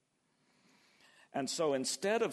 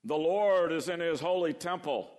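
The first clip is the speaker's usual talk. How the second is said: loud, distant and majestic; grand booming proclamation; 5 semitones higher, 8 dB louder than usual. Shown as a demonstration. Preacher booms the verse as the wrong picture. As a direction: proclaim it like a far-off king. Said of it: "No, that's not the image at all".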